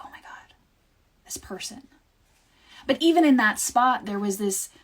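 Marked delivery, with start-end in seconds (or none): 0.0-1.9 breathy hushed whisper of infatuation; awed whisper. Retold or imitated. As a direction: whisper it as if the heart can't believe it. Heart speaking, awed.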